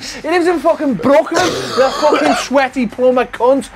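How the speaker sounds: mock outrage rant. Real, not acted. Loser ranting at his friend about Mario winning, mock furious. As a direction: rant like you've been robbed, half joking.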